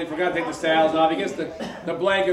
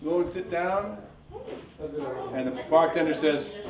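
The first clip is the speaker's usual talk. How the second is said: ordinary delivery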